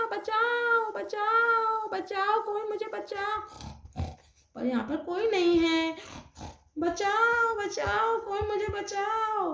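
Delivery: high, thin, frantic bachao; high cries for help. Acted. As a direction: cry out alone with nobody near. Geeta attacked, cries for help.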